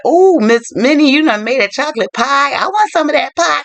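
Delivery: high, delighted mock exclamation; excited Miss Walters. Old employer spotting the cook's pie, playfully excited. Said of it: "Miss Walters like".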